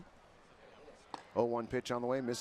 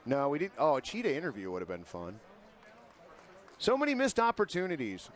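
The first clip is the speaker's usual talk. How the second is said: normal talking voice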